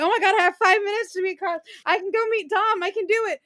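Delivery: high-pitched breathless excitement; retold excited rush. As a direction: race through it, giddy and high. Retelling frantic dash to meet actor.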